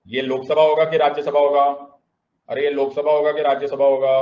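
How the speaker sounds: loud, rapid chanted question; fast and forceful classroom quizzing; shouted drill. Shown as a demonstration. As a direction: call it out to the whole class. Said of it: "सब लोग चिल्ला चिल्ला के बोलेंगे".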